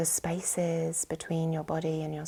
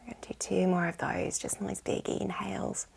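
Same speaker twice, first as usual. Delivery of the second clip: ordinary delivery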